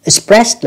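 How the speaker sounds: Expressed slowly, ending in a t sound; final T sound. Shown as a demonstration. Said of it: "là âm tờ".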